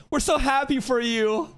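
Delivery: high, gushing congratulations in a put-on voice; mock happy couple. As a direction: gush sweetly as the delighted couple. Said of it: "and then says".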